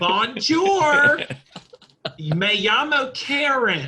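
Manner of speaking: high, loud, slow tourist; Karen French. Acted. Karen introducing herself in France, loud and slow. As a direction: bellow each syllable slowly at a foreigner. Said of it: "you gotta do Karen French".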